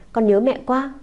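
put-on a small boy begging, pitch lifting on "mẹ". A narrator's reading. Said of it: "òa lên khóc nức nở".

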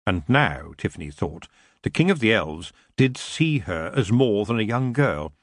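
plain reading voice

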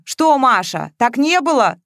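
heated accusation; accusing challenge. A narrator's reading. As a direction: demand that she admit it. Jealous man challenges her.

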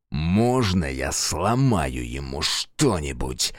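slow, low growl. Acted. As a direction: growl the request. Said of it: "прорычал Фергус".

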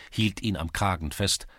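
usual speaking voice

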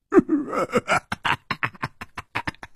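high, breathy, idiotic giggle; dim-witted giggle. Acted. Brutish henchman enjoys the threat, silly giggle. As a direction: giggle on foolishly and at length. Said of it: "那大汉竟然痴痴地笑起来".

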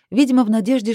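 ordinary delivery, nothing marked

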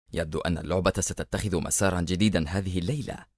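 low, soft aside to himself; sardonic muttering. A narrator's reading. Agent alone, musing on the night's game, quietly sardonic. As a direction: mutter it with understated mockery. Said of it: "ثم تمتم لنفسه في سخرية".

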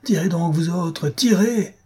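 orders shouted high, rising on the last tirez. A narrator's reading. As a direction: yell at his men to fire. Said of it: "apostrophant ses hommes". (Sholmes hurle à ses hommes de tirer)

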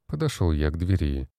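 plain reading voice

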